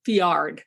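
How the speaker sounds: "fjord" said fee-ARD, with an ah; fiard with A. Real, not acted. Guide naming the sound below the inn, fiard with an ah. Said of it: "F J A R D as opposed to F J O R D".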